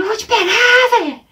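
high, loud hammed-up holler; chasing threat yell. Retold boy on quad bike after his rival, mock high yell. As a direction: scream after someone you are chasing.

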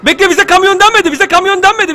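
high, loud rush of objection; panicked protest. Real, not acted. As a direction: protest frantically at being caught unaware. (telaşla itiraz ederek)

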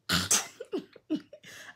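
high rising laugh at herself. Real, not acted. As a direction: let out an embarrassed laugh. Laugh after own story.

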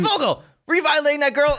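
very high, hammed-up outcry; mock accusing yell. Friend catching Fogel with a girl, mock outrage. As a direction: imitate a scandalized shout.

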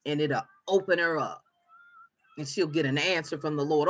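ordinary talk

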